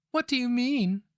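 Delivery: put-on high, loud, frightened girl's question; wincing in horror. A narrator's reading. Young girl asking the old woman, high and horrified. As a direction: ask it recoiling in horror. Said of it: "her voice wincing in horror".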